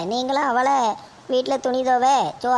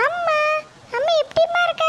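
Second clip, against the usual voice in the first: put-on very high small child's voice; little girl. Granddaughter greeting her mother, in a very high child's voice. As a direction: call out like a small girl running to mum. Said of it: "பாப்பா கூட்டிட்டு வாம்மா".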